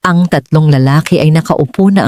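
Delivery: ordinary delivery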